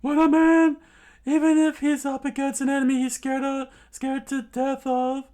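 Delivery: high, loud, fast yell; screaming. Acted. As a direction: scream it out to his friend. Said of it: "he screams out".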